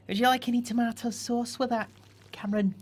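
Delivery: high, put-on Scottish accent; Scottish waitress. Playing the waitress asking Cameron a question in a Scottish accent. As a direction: sweetly ask like a Glasgow waitress. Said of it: "That was Scottish".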